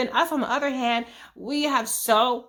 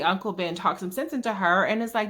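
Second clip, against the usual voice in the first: ordinary talk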